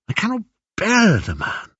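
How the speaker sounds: high, emphatic distaste; loathing. Acted. Penelope speaking of the suitors' leader, loathing. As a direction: recoil from the very thought of him.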